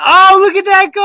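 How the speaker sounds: high, loud mock quote of the friend; imitating his friend. Mocking friend's last words before he left, high loud imitation. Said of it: "You said".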